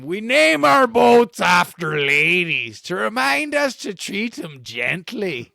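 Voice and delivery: put-on high, slow salty old sailor. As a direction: preach it like a grizzled old sailor. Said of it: "He tells them".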